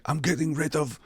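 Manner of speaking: put-on high, soft Hitler accent; put-on German accent for Hitler. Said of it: "doing the fucking accent".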